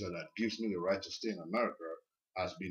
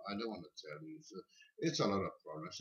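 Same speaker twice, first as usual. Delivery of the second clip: quiet reluctant mumble; 4 semitones lower, 9 dB quieter than usual. Retold or imitated. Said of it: "I'm like".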